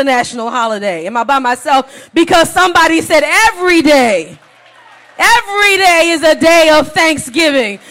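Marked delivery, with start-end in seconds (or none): 2.1-7.8 full-force pulpit preaching. Acted. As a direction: drive the point home to the congregation.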